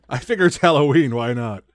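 bursting with Halloween cheer; excited repeat. Real, not acted. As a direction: say it again with gusto. Repeats line after laughing.

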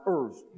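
"ers" as one syllable, like "errs"; "ers" in a Yorkshire-Cornwall style vowel; old pronunciation. Shown as a demonstration. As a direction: say hours as "ers", Shakespeare's way. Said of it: "the word hours was pronounced".

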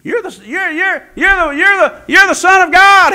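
panicked demon voices, acted by a preacher; demons crying out. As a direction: stutter it, then burst out high and loud.

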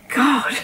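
soft breathy sigh before God; emotional sigh. Real, not acted. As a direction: let the feeling slip out on a sigh. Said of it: "I'm getting emotional".

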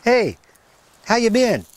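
friendly pet-owner hello, voice lifted; calling the dog. Real, not acted. Calling his dog over.

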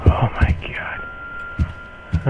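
slow breathy whisper. Real, not acted. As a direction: whisper it, spooked. Creeped out by the game.

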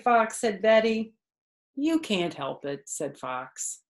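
lively storybook voice with character quotes; reading to children. A narrator's reading. Narrator reading a picture book to kids, lively storytelling. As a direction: read it aloud to a child, bright and animated.

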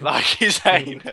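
slow words broken by laughter; laughing. Retold or imitated. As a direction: laugh through the quote. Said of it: "and I went".

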